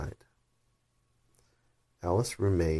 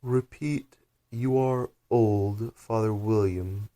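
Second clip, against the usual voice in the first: slow, lofty creature order; slow commanding caterpillar; 4 semitones higher, slower than usual. Acted. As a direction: drag it out like a bored command.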